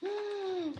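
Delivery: sharp high gasp of surprise; breathy gasp. Acted. Reader gasping at the monster's mischief. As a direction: gasp in mock shock. Storyteller reacting to pirates.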